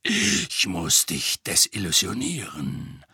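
low, slow, grave; a hint of a stern old soldier; gruff old auror. A narrator's reading. Moody tells Harry about the spell, low and slow.

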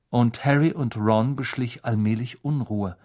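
ordinary delivery

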